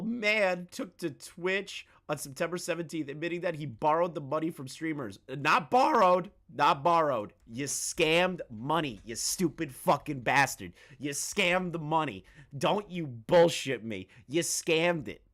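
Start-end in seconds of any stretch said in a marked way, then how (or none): 5.3-15.2 loud opening, hard emphatic correction; angry accusation. Real, not acted. Streamer rebutting a scammer who claims he borrowed, angry. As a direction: lay into the scammer word by word.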